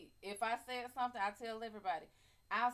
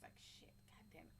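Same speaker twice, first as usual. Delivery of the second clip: whispered curse to herself; 5 semitones lower, 21 dB quieter than usual. Real, not acted. Streamer whispering a curse to herself after the cereal joke. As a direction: swear under the breath in a whisper. Muttering to herself about groceries.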